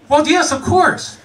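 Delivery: high, slow, overly earnest yes; eager yes. Retold or imitated. Telling roommate he wants to look his best, eager and high. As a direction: agree a bit too eagerly.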